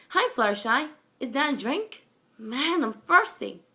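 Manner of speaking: cheery Twilight. Acted. Cheerful Twilight greets friend.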